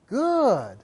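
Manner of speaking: "good" stretched with a soft glide; drawn-out praise. Shown as a demonstration. Trainer showing the long praise word, softened.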